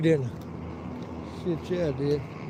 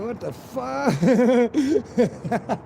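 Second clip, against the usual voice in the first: high quote dissolving into laughter; laughing disbelief; 8 semitones higher, much slower than usual. Retold or imitated. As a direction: break up laughing on the last word. Laughs while retelling his reaction.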